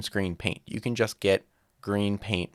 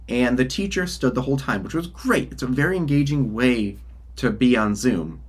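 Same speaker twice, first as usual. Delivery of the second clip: ordinary talk, nothing marked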